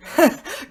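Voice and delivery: quick high laugh; bright laugh. A narrator's reading. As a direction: laugh at being caught out. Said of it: "Grace laughed".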